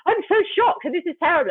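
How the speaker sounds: high-pitched imitation of public outrage; mock shock. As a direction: play everyone being oh-so-shocked. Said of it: "everyone goes".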